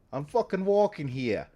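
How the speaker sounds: light New York street voice; New York accent. Retold or imitated. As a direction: say "walking" like a New York pedestrian, leaning on the word. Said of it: "they know about the New York accent".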